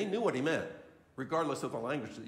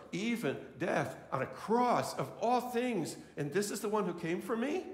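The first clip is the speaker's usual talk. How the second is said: awed wonder; 9 semitones higher than usual. Acted. Preacher marvelling that the King came to die for him, awestruck. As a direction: say it in astonished wonder.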